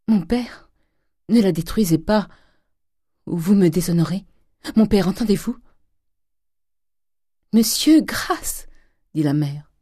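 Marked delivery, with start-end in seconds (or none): 0.1-5.6 higher-pitched, anxious appeal; urgent pleading. A narrator's reading. Daughter begging her father not to destroy it, urgent pleading. 7.5-8.7 high, drawn-out plea; begging for mercy. A narrator's reading. Mother begging her husband for mercy, anguished plea.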